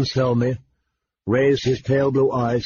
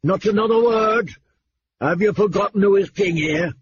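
angry rebuke; 8 semitones higher than usual. A narrator's reading. Angry king rebukes Ned.

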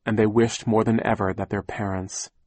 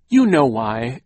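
loud, higher-pitched cross retort; 4 semitones higher, 8 dB louder, slower than usual. A narrator's reading. Aunt answering the children's accusation, cross. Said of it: "Aunt Josephine said crossly".

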